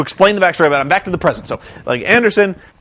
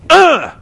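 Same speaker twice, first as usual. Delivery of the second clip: mock grunt of pain; 8 semitones higher, 5 dB louder than usual. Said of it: "He's like".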